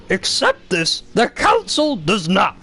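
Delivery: high, slow, squeezed Yoda croak with inverted phrasing. Retold or imitated. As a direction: do the little green master, drawn out and pinched. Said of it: "It's gonna be hard to do Yoda".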